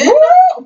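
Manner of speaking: loud high-pitched "woo"; sudden loud squealed whoop. Real, not acted. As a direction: let out a high whoop at the scandal. Reacting to shocking reunion moment.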